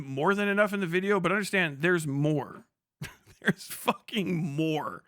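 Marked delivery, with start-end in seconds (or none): none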